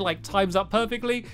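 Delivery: normal talking voice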